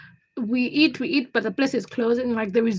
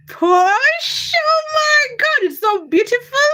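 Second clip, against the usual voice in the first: loud, high, stretched-out squeal; swooning over how gorgeous it is; awestruck raving; 12 semitones higher, 5 dB louder, slower than usual. Real, not acted. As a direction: gush in drawn-out amazement. Raving about the restaurant.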